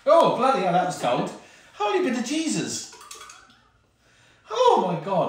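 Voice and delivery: loud gasping shout at the cold; shocked shout. Real, not acted. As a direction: yell from the cold water shock. Soaked with cold water.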